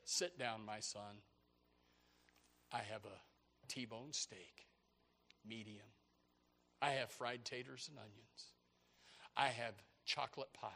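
low, soft fatherly host; the Lord. Acted. God seating his son before enemies, low soothing voice. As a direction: offer each dish calmly, with unhurried pauses. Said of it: "And the Lord says".

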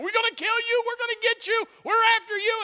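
high, hammed-up shouted threats; menacing enemy. Enemy threatening the man at God's table, acted shouting. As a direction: yell like an attacking mob. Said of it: "Here's the enemy".

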